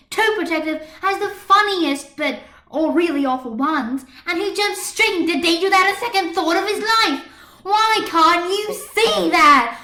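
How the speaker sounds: loud, high, exasperated yelling; angry outburst. A narrator's reading. Marinette exploding at Adrian over Chat Noir, loud angry. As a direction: unload everything at once. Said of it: "She was on a roll now".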